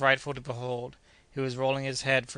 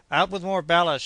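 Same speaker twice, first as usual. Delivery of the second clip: loud, higher command over the storm; urgent shout; 5 semitones higher, 7 dB louder than usual. A narrator's reading. Doctor orders ballast out in the storm, urgent shout. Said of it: "shouted the doctor".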